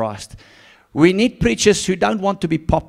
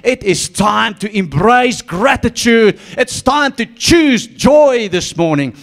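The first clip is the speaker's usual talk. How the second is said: higher, louder, slow preaching build; fiery preaching; 7 semitones higher, 6 dB louder, slower than usual. Acted. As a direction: proclaim it to the church, rising. Preacher rising to full force.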